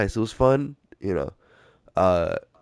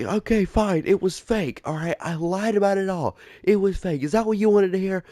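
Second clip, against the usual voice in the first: high, snippy imitation of the girlfriend; mocking girlfriend; 12 semitones higher than usual. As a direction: mimic her defensive confession in a high voice. Girlfriend confesses lie, voiced.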